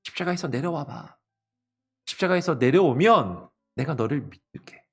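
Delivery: high-pitched needling jeer without shouting; mocking taunt. Onlookers taunting Jesus on the cross, mocking. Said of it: "사람들이 예수님을 조롱하고".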